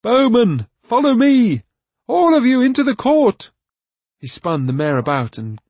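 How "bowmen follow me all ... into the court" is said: high, loud battle shout; rally the troops at full voice. A narrator's reading. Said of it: "shouted at the top of his voice".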